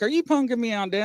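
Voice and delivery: high-pitched mock-aggravated daughter; imitated aggravation. Daughters fed up with dad's telescope, imitated aggravation. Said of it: "They're getting aggravated".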